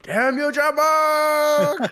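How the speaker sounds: long drawn-out high yell; mock outrage. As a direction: shake a fist at him, stretched out.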